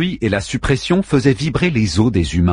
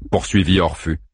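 usual speaking voice

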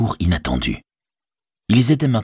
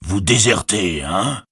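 voix d'orque menaçante; soupçonne-les en grondant; grondement d'orque; slower than usual. Acted. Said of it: "gronda-t-il". (orque soupçonneux qui gronde)

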